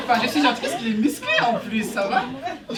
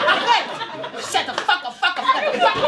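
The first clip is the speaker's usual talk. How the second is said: mock furious yell; 10 semitones higher than usual. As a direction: yell it over the laughter. Scolding a laugher.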